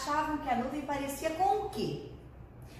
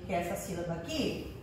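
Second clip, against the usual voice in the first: ordinary talk, nothing marked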